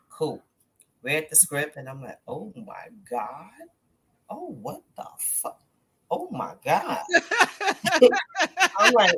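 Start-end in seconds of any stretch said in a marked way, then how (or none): 2.3-7.0 stunned, half-laughing disbelief; shocked reaction. Retold or imitated. Actor reading a wild script, stunned and laughing. 7.1-8.7 high, loud burst of laughter. Retold or imitated. Actor reacting to a wild script with loud laughter.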